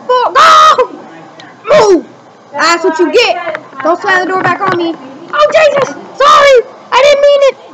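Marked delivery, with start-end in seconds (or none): 5.0-7.7 high, loud shouted sorry; sudden loud alarm then apology; panicked apology. Real, not acted. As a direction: yell apologies after crashing. Just wrecked another car.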